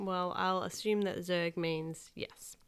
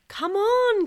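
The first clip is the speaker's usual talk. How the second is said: loud, high, drawn-out urging; 14 semitones higher, 11 dB louder than usual. A narrator's reading. Kenny urges his brother to jump, loud and high. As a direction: egg him on excitedly. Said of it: "Kenny urged".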